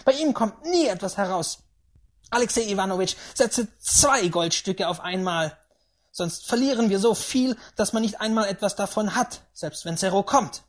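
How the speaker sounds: loud, high ranting; angry old woman. A narrator's reading. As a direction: rant furiously as a stubborn great-aunt. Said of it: "zürnte die Alte".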